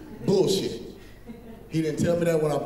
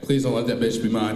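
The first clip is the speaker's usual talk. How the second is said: mock prayer; 8 semitones lower, 3 dB louder than usual. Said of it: "hear him praying".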